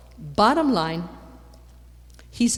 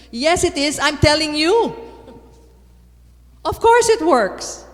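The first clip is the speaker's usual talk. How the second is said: much higher, much louder, insisting; emphatic shout; 9 semitones higher, 9 dB louder than usual. Real, not acted. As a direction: shout it out, sure beyond doubt. Preacher insisting it works.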